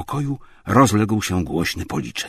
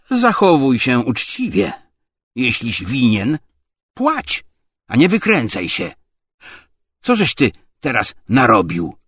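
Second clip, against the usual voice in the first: loud, raised-pitch rebuke; angry scolding; 6 semitones higher, 7 dB louder than usual. A narrator's reading. Tarantiew demands that Obłomow pay, angry scolding. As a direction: demand it accusingly. Visitor scolds his host.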